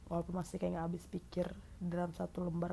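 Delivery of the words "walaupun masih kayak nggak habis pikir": normal talking voice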